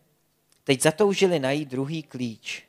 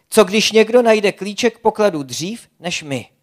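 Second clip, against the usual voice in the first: loud, higher fearful question; worried protest; 5 semitones higher, 8 dB louder than usual. A narrator's reading. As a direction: fret out loud. Zlatka fears losing the key.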